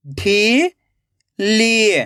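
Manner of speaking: ढीली split into ढी, long pause, ली; rising ढी, then falling ली; syllable by syllable. Shown as a demonstration. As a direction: sound out ढीली very slowly in two parts. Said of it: "एक एक अक्षर का उच्चारण करना है".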